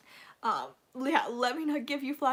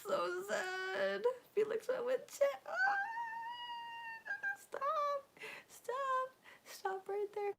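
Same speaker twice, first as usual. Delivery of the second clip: high, tearful wail; crying. Real, not acted. Fan recalling a sad moment to viewers, crying. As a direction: whimper it, pitched way up and drawn out. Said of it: "we're all crying right now".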